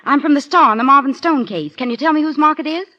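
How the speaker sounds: eager questioning. Acted. Woman reporter to laundry clerk about the mark, excited and insistent. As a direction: press eagerly for the answer.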